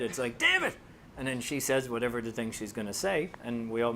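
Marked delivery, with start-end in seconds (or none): 0.4-0.8 sudden high-pitched exclamation; quick burst of mock exasperation; acted-out outburst. Shown as a demonstration. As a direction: jump right up in pitch as another person. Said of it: "you just have to change your voice a little bit".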